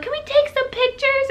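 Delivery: perky nurse voice. Retold or imitated. Nurses asking eagerly.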